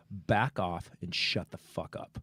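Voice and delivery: low, slow, soft warning; retold stern threat. Storyteller stepping between the men, low controlled threat. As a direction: warn him off slowly and quietly.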